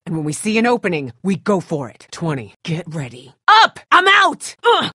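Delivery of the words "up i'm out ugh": high, loud strained yelling; frantic yell. Acted. Survivor out of ammo mid-fight, frantic yell.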